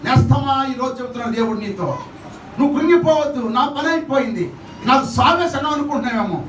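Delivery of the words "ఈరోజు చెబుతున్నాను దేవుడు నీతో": usual speaking voice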